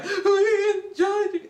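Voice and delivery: high, slow, teary mock sob; high wobbly crying student voice. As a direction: imitate students weeping their thanks. Students crying their thanks.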